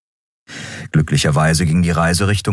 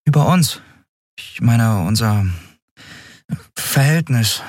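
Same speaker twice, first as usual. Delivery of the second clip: higher, hesitant question; Endriel, higher voice; 6 semitones higher than usual. A narrator's reading. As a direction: ask carefully, in a higher voice. Endriel asks Kero. (Endriel fragt Kero zögernd, höhere Stimme)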